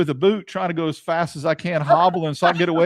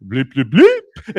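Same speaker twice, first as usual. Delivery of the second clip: mock PA announcer; 4 semitones lower, 6 dB louder, much slower than usual. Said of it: "P A announcer".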